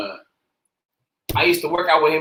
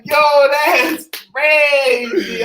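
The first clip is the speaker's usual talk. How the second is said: high, loud, long-drawn amazement; hyped, drawn-out exclamation; amazed reaction; 6 semitones higher, 8 dB louder, much slower than usual. Retold or imitated. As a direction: stretch it out in loud, awed disbelief. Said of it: "I was like".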